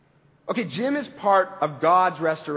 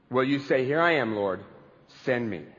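quiet offering; 6 semitones lower, 3 dB quieter than usual. Acted. Invites the answer to God.

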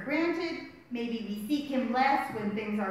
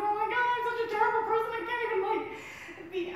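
fast, high dramatic self-reproach; mock self-scolding; 9 semitones higher, faster than usual. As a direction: rattle it off like scolding yourself. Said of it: "I call myself".

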